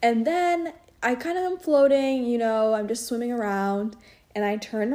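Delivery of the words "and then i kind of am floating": ordinary talk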